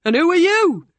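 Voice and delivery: challenging. Acted. Ryan challenges the new girl, high and loud. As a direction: throw the question at her loudly.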